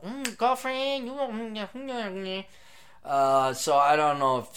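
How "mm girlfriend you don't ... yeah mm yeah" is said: put-on sassy girlfriend. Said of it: "You're just all very emotional".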